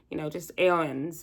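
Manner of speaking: ordinary talk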